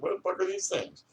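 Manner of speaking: high startled yelp; retold shock. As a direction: jump up in pitch, shocked. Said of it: "I was like".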